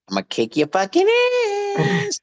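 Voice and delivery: last word leaps high and stretches out; Pee-wee catchphrase. Retold or imitated. Actor demonstrating his movie hook, squealed high character voice. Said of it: "So I came up with".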